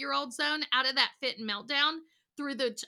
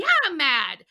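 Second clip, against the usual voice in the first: put-on kid's grumble; 4 semitones higher, 10 dB louder than usual. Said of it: "sometimes they're like".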